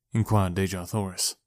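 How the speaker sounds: plain reading voice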